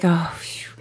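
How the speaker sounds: whispered sigh of relief; relieved whisper. Retold or imitated. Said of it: "I was like".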